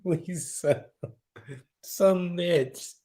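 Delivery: high, breathless with real laughter; cracking up. Real, not acted. Streamer cracked up by a viewer's comment, laughing helplessly. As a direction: squeeze the words out between laughs. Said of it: "that cracked me up".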